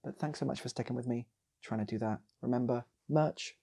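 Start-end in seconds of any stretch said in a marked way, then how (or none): none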